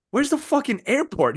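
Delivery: high, baffled demand about the airport. Retold or imitated. Stranded traveler asking the driver, high baffled demand. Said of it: "I'm like".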